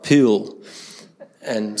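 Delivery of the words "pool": broad ocker "pool", vowel stretched towards "pewl"; mock broad Australian vowel in "pool"; ocker Australian accent. Shown as a demonstration. Preacher showing what Adelaide avoids, ocker accent on "pool". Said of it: "We're not ochre".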